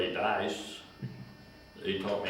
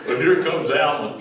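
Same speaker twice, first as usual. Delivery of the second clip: laughing; 7 semitones higher, 12 dB louder than usual. Real, not acted. Old man still laughing as he goes on.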